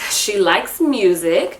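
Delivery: usual speaking voice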